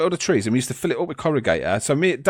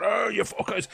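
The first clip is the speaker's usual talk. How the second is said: mock angry; 10 semitones higher, 6 dB quieter than usual. Man pelted with stones on the stairs, mock angry. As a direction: act out his rising temper, held back in volume. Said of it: "getting angry now as well".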